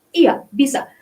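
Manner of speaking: ordinary talk, nothing marked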